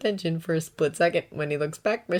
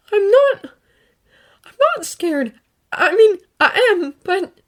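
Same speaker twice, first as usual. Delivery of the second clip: much higher, louder, words tumbling out; trying to deny his fear and failing; frightened boy; 13 semitones higher, 8 dB louder than usual. A narrator's reading. Izuku admitting fear to All Might, high and shaky.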